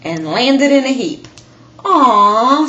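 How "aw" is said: disappointed sigh. Acted. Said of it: "Splat sighed".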